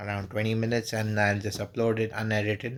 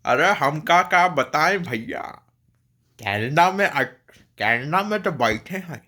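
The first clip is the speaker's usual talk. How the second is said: animated Bhojpuri accent with swooping pitch; Bhojpuri accent imitation; 3 semitones higher, much faster than usual. Shown as a demonstration. Vlogger imitating for Indian friends, Bhojpuri accent. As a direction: play up a lively, sing-song Bhojpuri accent. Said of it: "a Bhojpuri accent".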